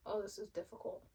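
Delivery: ordinary talk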